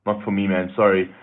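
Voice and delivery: ordinary delivery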